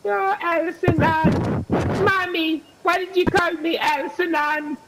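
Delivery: put-on high, slow whiny kid voice; mock child voice. Mocking the opponent's name, whiny child voice. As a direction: whine it like a little kid to mommy.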